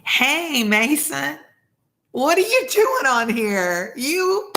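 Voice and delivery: high, delighted call to a viewer; light up at a familiar name; a surprised teacher spotting a former student; excited greeting. Real, not acted. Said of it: "greets a student in chat".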